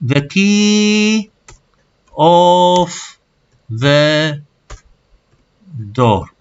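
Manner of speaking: drawn-out, halting word by word, high then falling; slow translating aloud with long pauses; thinking it out. Retold or imitated. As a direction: stretch each word like a student puzzling out a translation. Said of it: "أغلبكم راح يفكر بينه وبين نفسه".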